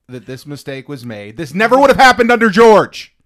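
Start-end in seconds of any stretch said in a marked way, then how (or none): 1.4-3.1 high, loud shout of fake indignation; mock outrage. Host joking about a continuity error, mock-outraged shout. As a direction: yell it like a wildly indignant fan.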